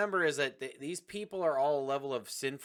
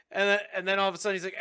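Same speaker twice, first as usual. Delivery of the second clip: ordinary delivery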